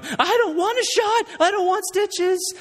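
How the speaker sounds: put-on a scared kid refusing the needle; panicking little boy. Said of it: "Emmett starts to, to lose it".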